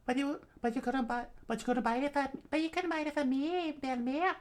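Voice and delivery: put-on very high, hesitant child voice; squeaky kid falsetto, faltering; questioning little girl. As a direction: stammer out the objection as a small girl. Child Nami questions Belmere.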